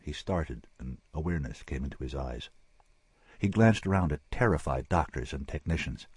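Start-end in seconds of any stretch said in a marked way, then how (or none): none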